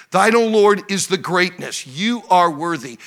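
loud, high, stretched-out proclamation; rousing pulpit delivery, drawn-out key words; full-force preaching. Real, not acted. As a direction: hammer each attribute home to the congregation.

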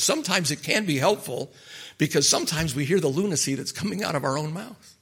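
ordinary talk

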